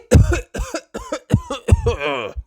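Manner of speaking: squeaky high cough; hammed-up high cough; high pony cough. Pedro coughing.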